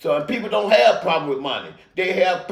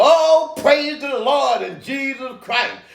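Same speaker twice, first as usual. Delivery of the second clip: mock preacher; 6 semitones higher, slower than usual. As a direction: ham up a pulpit preacher's praise. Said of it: "motherfucker in the pulpit talking about".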